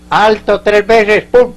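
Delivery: loud, high-pitched shouted order; grito de centinela. Retold or imitated. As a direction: shout the bungled challenge as a punchline. (grito fuerte y agudo, imitando al soldado bruto)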